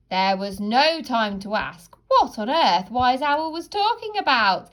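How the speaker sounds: plain reading voice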